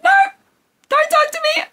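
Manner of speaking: very high, strained crying voice; mock sobbing wail. Retelling sobbing at friend who asked if she's okay, high wailing. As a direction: wail it like you're sobbing too hard to talk.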